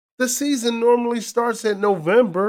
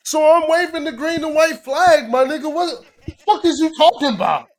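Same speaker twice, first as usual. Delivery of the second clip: higher and louder, laughing indignation; excited mock outrage; 9 semitones higher, 6 dB louder than usual. Real, not acted. Mock outrage over Nigerian heritage.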